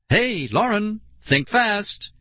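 high, sudden call; mild playful shout, pitch up. A narrator's reading. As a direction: call out high to catch her off guard. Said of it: "Suddenly she shouted".

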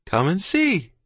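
high call to come over; moderate, high-pitched shout. A narrator's reading. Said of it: "he shouted".

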